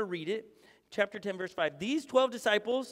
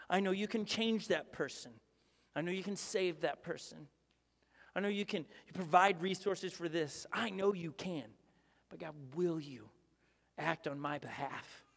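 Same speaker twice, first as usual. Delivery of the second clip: soft, sinking prayer voice, slowing at the end; hushed prayer; 4 semitones lower, 6 dB quieter than usual. Retold or imitated. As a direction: pray it almost under your breath. Whispered-down prayer to God.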